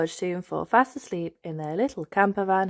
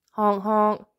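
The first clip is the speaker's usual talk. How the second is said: horn imitation; 3 semitones higher, 3 dB louder than usual. Camper van horn arrives.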